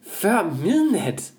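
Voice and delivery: incredulous. Real, not acted. Incredulous about dinner running late.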